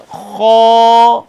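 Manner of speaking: خا loud and full, ending on a clean alif; teacher asks student to follow her خا; model letter. Shown as a demonstration. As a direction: say خا strongly, finish with alif not ha. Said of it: "مجھے follow کریں".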